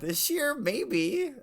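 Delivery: high, sing-song echo of the line; teasing echo. Acted. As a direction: echo it back playfully.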